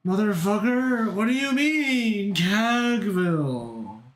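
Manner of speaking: high, drawn-out question sinking at the end; exasperated disbelief. Real, not acted. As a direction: stretch it out in disbelief. Exasperated at a made-up place.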